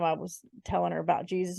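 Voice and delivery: ordinary talk, nothing marked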